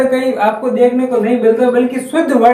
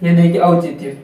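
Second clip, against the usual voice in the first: usual speaking voice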